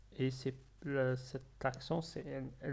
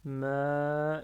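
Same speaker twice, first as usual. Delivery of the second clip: MA drawn out long and loud; prolonged syllable; 6 dB louder than usual. Shown as a demonstration. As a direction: stretch the vowel of "ma" while stalling. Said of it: "en train de prolonger la lettre ma". (« ma » prolongé, en attendant la suite)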